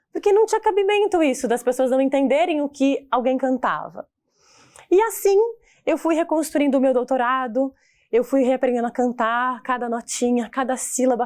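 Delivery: normal talking voice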